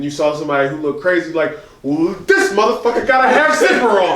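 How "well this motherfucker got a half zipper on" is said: high mock-outraged roast; laughing-along heckle in a high voice; deflecting jeer. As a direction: throw the joke onto someone else, pitched up. Deflecting a roast onto another.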